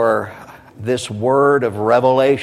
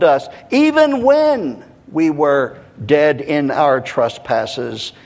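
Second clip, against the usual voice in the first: raised, emphatic preacher's scripture reading; rhythmic, deliberate reading; 4 semitones higher, 4 dB louder, slower than usual. Real, not acted. Preacher reading of God's great love, emphatic and rhythmic. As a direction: read it rhythmically, higher and ringing out the key words.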